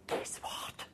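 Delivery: hushed secret whisper. Retold or imitated. The shop lady leaning in to share news, whispered. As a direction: breathe it like a secret. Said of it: "she looked at me and she said".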